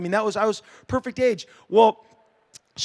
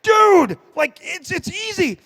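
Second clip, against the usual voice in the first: starstruck; 10 semitones higher, 5 dB louder, slower than usual. Retold or imitated. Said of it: "And I was like".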